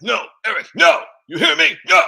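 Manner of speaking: high, loud mock yell of refusal; Nancy yelling no. Nancy firmly refuses Eric's plea, high loud mock yell. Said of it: "And Nancy's like".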